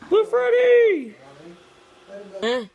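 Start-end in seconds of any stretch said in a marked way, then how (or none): 0.1-1.2 calling out. Pretend.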